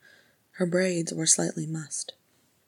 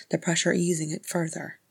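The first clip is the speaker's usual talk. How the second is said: ordinary delivery, nothing marked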